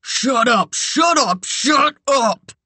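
high, frantic repeated shouting; incensed, out-of-control yell; enraged shout. A narrator's reading. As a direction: explode in rage, each command pitched high. Incensed man strangling her.

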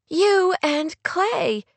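high, drawn-out sharp retort. A narrator's reading. Kate answers Rory's question about Clay, sharply. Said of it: "she said sharply".

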